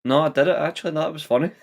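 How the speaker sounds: high-pitched, cracking up; amused. Real, not acted. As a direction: talk through rising laughter. Cracking up at own accent.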